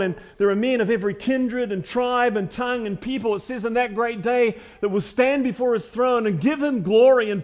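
rising fervent preaching; swelling sermon climax. Acted. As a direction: lift the hall with it. Preaching at full force.

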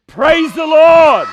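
loud, high drawn-out praise shout; full-volume shout. Shown as a demonstration. Preacher leading the young people on the count of three, shouting loud. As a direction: shout it out as loud as you can. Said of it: "shout out as loud as you can".

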